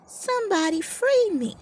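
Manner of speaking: very high, squeaky cries of help; a tiny trapped mouse screaming to be freed; mouse yelling for help. A narrator's reading. Trapped mouse yelling to anyone passing by, high squeaky cries. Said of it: "the mouse yelled".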